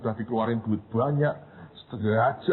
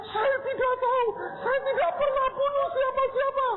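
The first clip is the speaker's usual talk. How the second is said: tearful denial. Retold or imitated.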